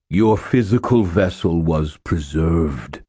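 otherworldly ancient spirit; lightly voiced island spirit; psychic spirit voice. A narrator's reading. Demon Reach speaks.

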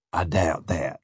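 low, soft dismissal; dry captor. A narrator's reading. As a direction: brush off the plea quietly. Captor scoffs at the plea.

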